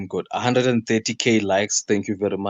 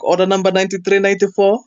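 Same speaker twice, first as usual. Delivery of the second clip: high, fast, loud counter call; lady calling order numbers; 11 semitones higher, 7 dB louder, faster than usual. Retold or imitated. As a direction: sing it out like a fast-food cashier. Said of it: "some lady".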